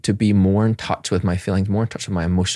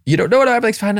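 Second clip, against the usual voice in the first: mock brush-off; 13 semitones higher, much faster than usual. Dad brushing off his children's question, mock dismissive. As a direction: wave it away quickly.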